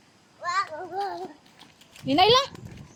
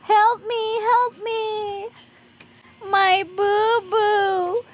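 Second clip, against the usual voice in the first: playful pretend whimper, long stretched words; mock hurt wailing; 3 semitones higher, much slower than usual. Parent playing hurt for the child, mock wailing. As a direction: wail over a pretend boo-boo, drawn out.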